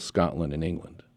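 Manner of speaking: ordinary talk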